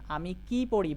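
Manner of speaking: কি leaned on and lengthened; wh-question with emphatic কি; stress on কি. Shown as a demonstration. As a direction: make কি the strong word, falling after it. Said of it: "তখন সেটা হয়ে যাবে W H question".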